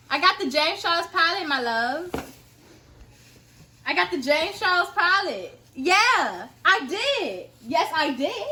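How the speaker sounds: excited bragging. Real, not acted. Telling her father about the palette, gleeful and loud. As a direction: show off the win.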